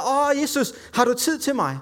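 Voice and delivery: high-pitched mock plea; acted cry for attention; calling out. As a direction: call out pleadingly without real volume. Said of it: "sidder der og råber og siger".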